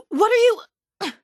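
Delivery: high, loud, drawn-out outcry; alarmed shout. Acted. Experimenter startled by the untied test subject, alarmed high shout. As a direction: cry out in sudden alarm.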